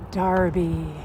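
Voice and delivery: ordinary delivery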